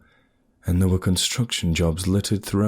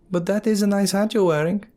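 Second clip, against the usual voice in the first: huntress voice; 14 semitones higher than usual. Acted.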